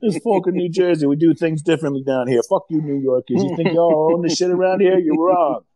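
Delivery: mock-belligerent New Jersey local; put-on New Jersey tough talk; hammed-up New Jersey accent. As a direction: play a New Jersey guy telling off outsiders. Hamming up a Jersey tough guy.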